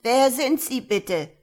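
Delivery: loud, high, slow alarmed question; startled. A narrator's reading. Confused old woman suddenly not knowing the officer, startled and loud. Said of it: "fragte Frau Runder erschrocken".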